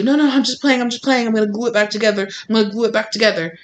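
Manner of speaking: high, fast, pleading mock voice; mock backpedalling. Mimicking someone rushing to undo a breakup, fast and high. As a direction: hurry to take it all back.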